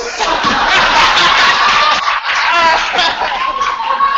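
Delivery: loud high burst of laughter, real; big real laugh. Real, not acted. Laughing hard while telling friends the story. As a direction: crack up at the punchline.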